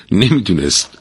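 ordinary delivery, nothing marked

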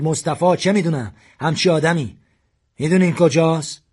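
furious demand for the killer; shouting. A narrator's reading. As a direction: yell it while waving the gun. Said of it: "و داد زد".

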